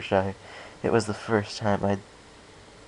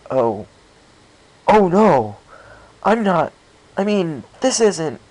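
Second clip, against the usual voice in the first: louder, higher, tripping over his words; flustered panic; 10 semitones higher, 8 dB louder, slower than usual. A narrator's reading. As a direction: blurt it out in alarm. Chaser realizes his blunder.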